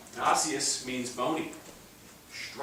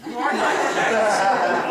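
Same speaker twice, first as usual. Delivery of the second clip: loud, slow laughing echo of Frank's line; 9 semitones higher, 15 dB louder, slower than usual. Real, not acted. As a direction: repeat it back while laughing.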